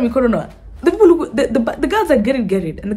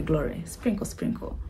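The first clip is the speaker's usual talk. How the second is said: unvoiced whisper, almost nothing; 6 semitones lower, 13 dB quieter than usual. Real, not acted. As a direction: breathe it out as a secret. Whispered aside about digesting.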